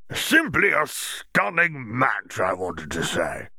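high, slow, gushing official; Bagman, hearty. Acted. Hearty Bagman gushing congratulations to Hermione, high and slow. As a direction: lay on the enthusiasm like a jolly sports official.

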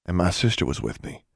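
ordinary delivery, nothing marked

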